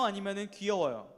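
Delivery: usual speaking voice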